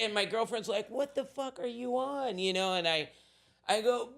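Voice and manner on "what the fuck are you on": high, mock shocked girlfriend; lightly voiced scolding girlfriend. Girlfriend to her drugged boyfriend, mock shocked.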